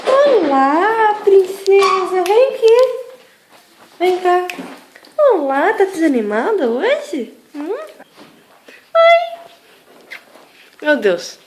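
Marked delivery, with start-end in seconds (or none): 0.1-5.7 high, loud, thrilled calling to the dog; excited dog greeting. Real, not acted. 8.9-9.4 very high, loud squeaky hi; squealed hi. Real, not acted. Squealing hello to dog.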